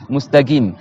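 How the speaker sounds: mustagim with qaf as g; hard g replacing q in mustaqim; Medina g in mustaqim. Shown as a demonstration. Teacher shows the dialect of Medina, demonstrating g for qaf. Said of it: "ils font leur qaf ga".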